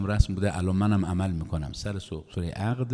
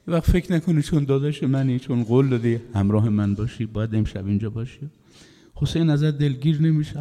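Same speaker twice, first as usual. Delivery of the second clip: Hussein to his brother; 5 semitones higher, 3 dB louder than usual. A narrator's reading. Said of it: "گفت داداش".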